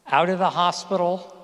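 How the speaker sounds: ordinary talk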